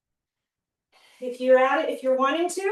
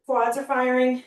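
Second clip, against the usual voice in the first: usual speaking voice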